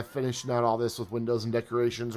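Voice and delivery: normal talking voice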